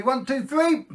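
high, building magic countdown; excited countdown. Acted. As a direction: count them in to the big reveal.

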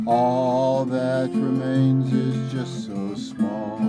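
usual speaking voice

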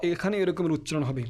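usual speaking voice

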